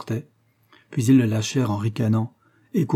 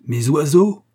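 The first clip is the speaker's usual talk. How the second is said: loud, higher outraged exclamation; ringing cry; 5 semitones higher, 7 dB louder, slower than usual. Acted. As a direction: cry it out so it rings through the room. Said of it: "s'écria-t-il d'une voix retentissante".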